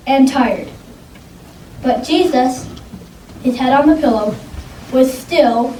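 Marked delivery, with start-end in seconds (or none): none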